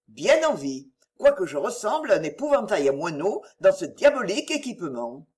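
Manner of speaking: plain reading voice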